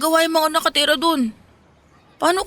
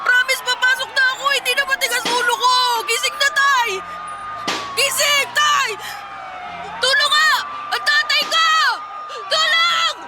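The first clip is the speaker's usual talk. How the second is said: frantic screaming for father; 7 semitones higher than usual. Acted. Son discovers his father not breathing, screams for help. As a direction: scream him awake, desperate and breaking.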